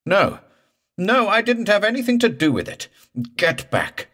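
high, loud, hurried protest; panicked denial. A narrator's reading. Morse warding off the drowned man, high panicked denial. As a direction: protest frantically and push it away. Morse denies the thing at the boat.

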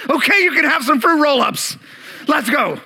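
loud, high burst of giving in; exasperated outburst. Acted. Parent giving in to a child at Walmart, frustrated shout. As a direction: snap after being pestered too long. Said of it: "frustration, I think, is happening".

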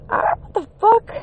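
high squeak of disbelief; stunned disbelief. Real, not acted. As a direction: shriek it like you can't believe it. Reacting to the comeback.

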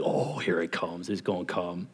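low, very soft aside; hushed group dread. Retold or imitated. Counselors bracing for the director's correction, low hushed mutter. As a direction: imitate counselors muttering low among themselves. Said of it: "we're like".

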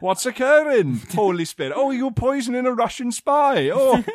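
put-on high, loud sing-song Welsh accent. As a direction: ham up a Welsh accent, laughing. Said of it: "I can't do a Welsh accent".

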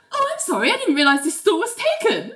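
high, sweetly put-on apology; mock innocent apology. Elaine feigns innocence climbing into stall.

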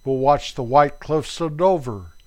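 usual speaking voice

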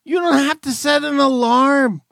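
high, strained disbelief; exasperated lecture. Retold or imitated. Host berating a retiree in high strained voice. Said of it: "You're like".

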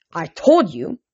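restrained irritation; through gritted teeth. A narrator's reading. Hades answering his mother-in-law, through gritted teeth. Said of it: "gritting his teeth".

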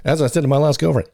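ordinary delivery

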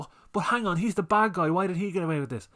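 high, whining mock complaint; mock a childish moviegoer, pitched high; whiny childish viewer. Mimicking a whiny viewer upset the bad guy got away. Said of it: "they'll behave like a child".

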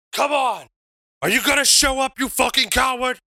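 high-pitched yell into open air; acted taunting challenge. Wrestler throws the mic down and goes to the open air, yelled taunt. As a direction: call the rival out, pitch pushed way up.

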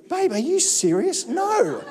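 high-pitched mock disbelief; mock offended. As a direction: act incredulous, as if insulted by your partner. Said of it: "I was almost offended".

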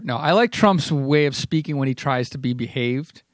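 ordinary talk, nothing marked

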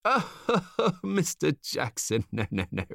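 slow, chuckling salesman; amused chuckle. A narrator's reading. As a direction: laugh off the idea. Stranger laughs.

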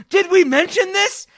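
high, loud frantic yell; panic-yell the question at a friend. Retold or imitated. [name] frantically yelling at his cohost over text. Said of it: "I texted Zach very frantically".

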